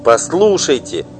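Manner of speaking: firm, high and loud; decisive tone. Acted. Wife halting before the singer, resolute loud tone. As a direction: stop her with a firm command. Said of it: "решительным тоном".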